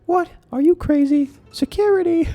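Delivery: very high, loud call for security; outraged yell. Acted. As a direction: scream for the guards in outrage. Guide yells for security.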